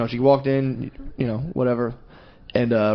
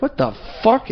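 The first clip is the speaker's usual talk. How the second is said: high-pitched retold indignation; mock angry; 9 semitones higher than usual. Coffee purists react to a sweetener, mock angry. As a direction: act out their outrage without extra volume. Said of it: "they would get really angry".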